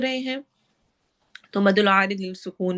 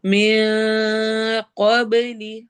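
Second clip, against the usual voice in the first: slow, drawn-out tajweed chant; Quran recitation; much slower than usual. Shown as a demonstration. Teacher recites verse for students, slow tajweed chant. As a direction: recite it slowly, stretching each sound. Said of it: "क़ाफ को मोटा और बा पे कलकला".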